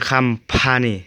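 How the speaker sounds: "company" said as "kham-pha-nee", with an a; correct pronunciation. Shown as a demonstration. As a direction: say "company" starting "kum", not "kom". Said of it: "มันจะบ่อ่าน com".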